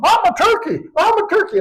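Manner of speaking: high squeaky bird-like cries; squawking turkey boy. Acted. Boy discovering he's a turkey, high squawking cry.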